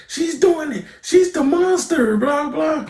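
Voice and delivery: high-pitched imitation of an accusing kid; mock accusation. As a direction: squeal the blame in a put-on voice. Acted accusation in a big fight.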